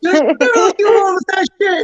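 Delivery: crying wail. Retold or imitated. Son answering his mom while crying, wailing. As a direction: wail it through tears.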